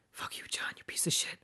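hissed whisper curse. Retold or imitated. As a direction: whisper it through clenched teeth. Cursing driver under breath.

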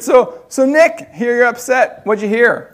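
confronting judge. Retold or imitated. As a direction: imitate an authority calling someone out. Voices judge confronting him by phone.

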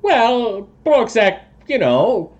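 high, hedging toad-man voice; sheepish toad creature. Acted. Toad person admits what he did, high and sheepish. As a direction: make excuses in a high creature voice.

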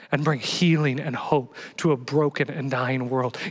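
ordinary talk, nothing marked

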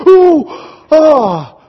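sharp, high, loud cry of pain as the ankle is pressed; a retold yelp when the thumb digs in. Chiropractor presses swollen ankle.

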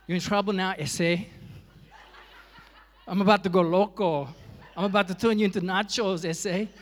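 loud, hammed-up Mexican accent tough-guy threat; boastful Mexican-accented taunt, half joking; mock Mexican accent. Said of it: "I don't know why I turned Mexican".

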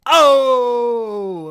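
high, loud, drawn-out "oh"; long mock-scandalized shout; heckler's objection. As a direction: holler it from the crowd. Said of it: "I hear somebody go".

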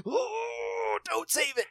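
high strained wail, then pleading; mock exasperation. Dad begs daughter to let it go, exasperated. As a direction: plead like a worn-out parent, hammed up.

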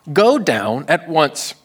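irked, pointed blame on the other parent; cranky God. Acted. Cranky God scolding Moses about the people, exasperated. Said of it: "God is kinda cranky here".

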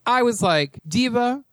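mock Compton accent, rising at the end. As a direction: have a tentative go at a Compton accent. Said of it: "in his best Compton accent".

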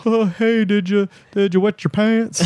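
high, put-on needling voice; teasing brother. Brother to sister after she falls in the water, mock teasing. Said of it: "he's like".

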